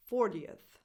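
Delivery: "fortieth" in three syllables, for-tee-uth; extra schwa. Shown as a demonstration. Teacher modelling the ordinal of forty, "fortieth" with extra schwa. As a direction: add a clear schwa before the TH in "fortieth". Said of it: "a little extra sound, a little extra schwa".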